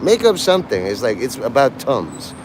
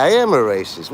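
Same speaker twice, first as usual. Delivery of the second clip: ordinary delivery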